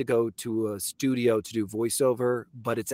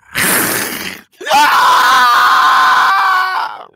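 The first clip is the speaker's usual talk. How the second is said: monstrous shriek-laugh; 14 dB louder than usual. Acted. Ghoul finale, loud laugh.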